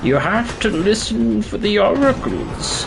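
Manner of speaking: put-on high, slow creaky old man; quavering grandad voice. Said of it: "that's gonna be my old man voice".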